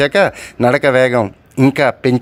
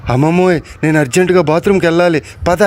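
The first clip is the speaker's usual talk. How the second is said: hurried urgent plea; 4 semitones higher, faster than usual. Acted. Scared child urging grandmother.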